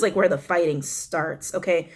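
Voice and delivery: ordinary delivery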